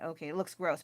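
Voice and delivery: ordinary delivery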